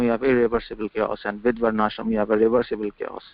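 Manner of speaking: ordinary talk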